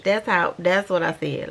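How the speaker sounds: usual speaking voice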